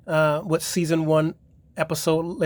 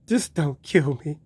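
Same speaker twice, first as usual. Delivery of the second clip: scared plea; slower than usual. Retold or imitated. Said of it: "she was all scared of him".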